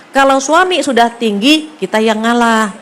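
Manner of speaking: ordinary talk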